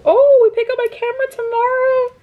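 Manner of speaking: high, bright burst of excitement; sudden high excited announcement; excited squeal. Real, not acted. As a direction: squeal it out, thrilled. Excited news about the camera.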